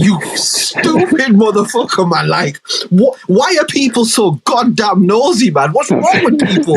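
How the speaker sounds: high, shouting mock outrage; hammed-up fury at a nosy stranger; furious rant. Failed suicide cursing out his rescuer, high mock shouting. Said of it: "And you're like".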